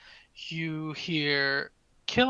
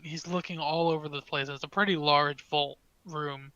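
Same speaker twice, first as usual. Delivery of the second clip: ordinary talk, nothing marked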